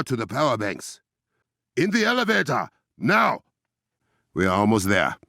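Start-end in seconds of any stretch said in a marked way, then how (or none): 1.8-3.4 urgent shout. Acted. Urgent command in combat.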